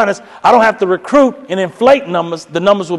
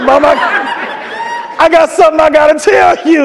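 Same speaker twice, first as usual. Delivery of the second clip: louder, higher blurted confession; husband confessing to wife, louder blurt; 9 semitones higher, 4 dB louder than usual. Retold or imitated. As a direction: blurt it out to her.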